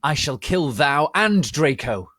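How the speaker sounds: hammy evil villain declaring a kill; villain threat. Acted. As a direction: menace them like a storybook villain.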